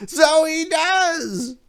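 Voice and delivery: very high, slow drawn-out gloat; gloating priest. Acted. Belshazzar mocking Daniel, high and slow. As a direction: savor every word.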